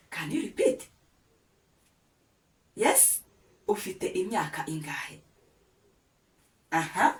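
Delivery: usual speaking voice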